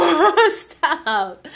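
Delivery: slow, laughing I'ma stop. Real, not acted. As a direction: laugh through it, stretched out.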